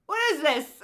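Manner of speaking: high, loud what is this; incredulous cry. Retold or imitated. Retelling her dream reaction, loud high disbelief. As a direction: exclaim in disbelief. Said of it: "I was like".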